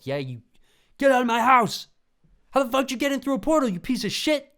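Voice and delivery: high, loud, fast screaming rant; shrieking homeowner rage; furious screaming. Acted. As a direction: scream at the intruder to get out. Future self yelling at intruder.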